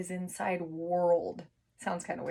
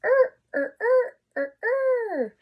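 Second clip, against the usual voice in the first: er er much higher and louder; sing-song er; 8 dB louder, slower than usual. Shown as a demonstration. Teacher to kids, high playful er chant. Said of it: "er sisters".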